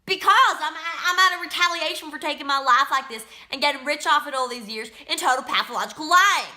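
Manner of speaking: high, heated, bitter outburst; real angry tirade. Real, not acted. Prisoner blaming others for her lost life, angry. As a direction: let the grievances pour out with force.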